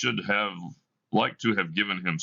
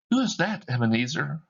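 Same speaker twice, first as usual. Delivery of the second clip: put-on much higher, bright child's voice; child calling her brother home; little girl Fan; 6 semitones higher, faster than usual. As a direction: play a six-year-old sister.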